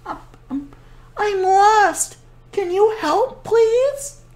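soft, high, trembling little bunny; tiny frightened bunny. Acted. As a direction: whisper it like a scared lost bunny. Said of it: "a whisper-soft voice".

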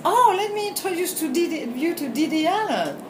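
high, bright mock-eager friend; imitating an eager friend making introductions. Friend introducing, much higher, louder.

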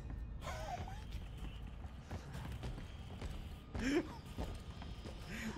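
long, soft, high laugh. Real, not acted. Gamer amused by their own mistake, laughing. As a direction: laugh helplessly, mostly breath.

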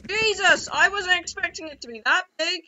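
high, loud real shock; startled outburst. Real, not acted. Player startled by a huge enemy, startled outburst. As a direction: cry out, caught off guard by the size.

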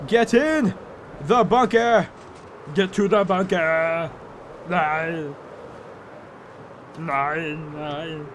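hammed-up Austrian accent, drawn-out cries; Arnold Schwarzenegger. Farmer fleeing a tornado to the bunker, mock Austrian accent. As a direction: mock-desperate action-hero impression, long vowels. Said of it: "I am Arnold".

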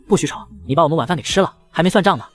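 ordinary delivery, nothing marked

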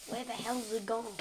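loud, brisk mock outburst; retold puzzled exclamation. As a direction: blurt it out like a retold reaction. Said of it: "looked at my drawers and went".

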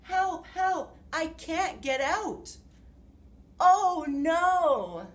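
high, loud frog calling for help; croaking frog cry for help. Acted. Frog calls for help from the hole, high loud cry. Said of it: "croaked Frog".

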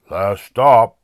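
loud bus driver's announcement; calling out. A narrator's reading. Driver waking the sleeping passengers, calling out. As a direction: belt it out down the bus. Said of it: "called the driver".

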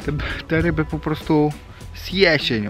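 ordinary delivery

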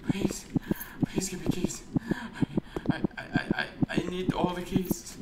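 high, whispery, stammered pleading; mock desperate begging. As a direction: beg for it, hammed-up and breaking into whisper.

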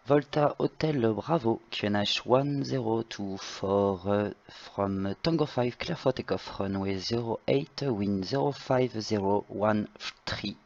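ordinary talk, nothing marked

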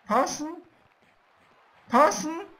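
high, rising urgent question. Real, not acted. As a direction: beg for the pass excitedly. Pleading for a pass. (aufgeregt fragend)

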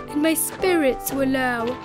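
ordinary delivery, nothing marked

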